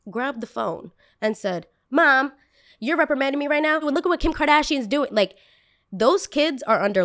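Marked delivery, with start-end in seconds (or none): 1.9-5.1 high, fast, sassy talk-back; sassy comeback. Pretend. North West sassing her mother, high and fast. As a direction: fire back at mom quick and high.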